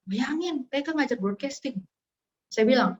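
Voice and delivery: usual speaking voice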